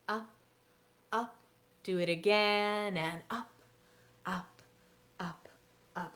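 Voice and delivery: quiet slow classroom cues; soft guided routine. Shown as a demonstration. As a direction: guide the children gently. Leads kids' scarf routine.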